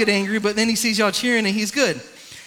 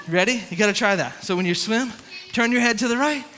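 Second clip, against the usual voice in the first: normal talking voice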